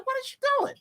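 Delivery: high incredulous voice; baffled question. Retold or imitated. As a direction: squeak out disbelief. Self voiced confused.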